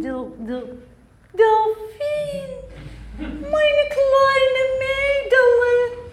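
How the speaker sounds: slow, sung grandmother voice; ancestor spirit. Acted. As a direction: croon like a ghostly grandmother.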